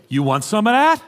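challenging New York "You want some of that?"; brash New York street challenge; New York taunt. Retold or imitated. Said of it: "they'll say".

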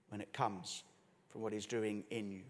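normal talking voice

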